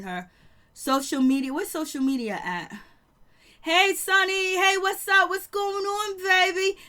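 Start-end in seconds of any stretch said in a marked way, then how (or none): 3.6-6.8 mock cheery greeting. Mimicking cheery on-screen greetings.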